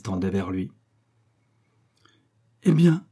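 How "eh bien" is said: high, loud exclamation; exclaiming. A narrator's reading. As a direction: call it out sharply as the marquise. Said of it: "s'écria Onesta". (s'exclamer d'une voix haute et forte)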